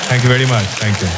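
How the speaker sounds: low, deeply flattered thanks; grateful Indian voice. Acted. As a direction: thank him as if complimented. Grateful Indian reply, much lower.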